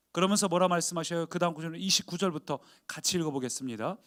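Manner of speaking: normal talking voice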